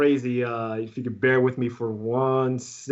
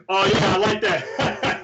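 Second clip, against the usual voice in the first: lightly imitated, drawn-out voice a bit higher; a slow, playful imitation of a star overheard; Will Smith; 5 semitones higher, 3 dB louder, slower than usual. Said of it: "in the hallway, I hear".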